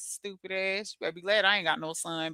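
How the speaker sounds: ordinary delivery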